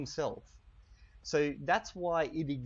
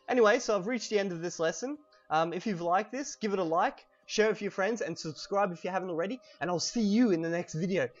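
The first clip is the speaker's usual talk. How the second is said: higher, louder and fast sign-off; upbeat outro; 5 semitones higher, 8 dB louder than usual. Acted. Youtuber to viewers, loud cheerful outro. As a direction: pump up the viewers to subscribe.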